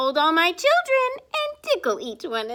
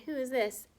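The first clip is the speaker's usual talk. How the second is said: plain reading voice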